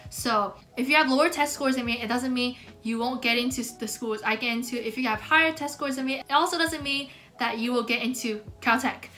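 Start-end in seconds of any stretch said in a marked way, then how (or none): none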